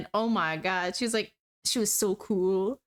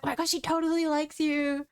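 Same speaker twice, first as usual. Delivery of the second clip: higher, teasing squeal; excited coworker; 6 semitones higher than usual. Retold or imitated. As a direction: tease her friend, higher. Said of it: "She's like".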